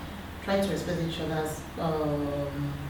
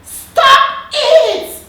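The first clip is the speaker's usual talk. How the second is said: put-on much higher, louder child's cry, laughing through it; upset little girl; 14 dB louder than usual. Seven-year-old daughter telling quarrelling parents to stop it. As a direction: squeal it like a seven-year-old banging the table.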